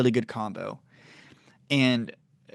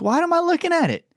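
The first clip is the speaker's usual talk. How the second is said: high, bewildered self-question; puzzled at myself; 3 dB louder than usual. Retold or imitated. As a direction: ask yourself, baffled and much higher. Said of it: "And I'm like".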